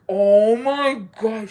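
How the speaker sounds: shocked oh my God. Retold or imitated. Retelling seeing her classmate's butt, drawn-out shock. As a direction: stretch it out in disbelief.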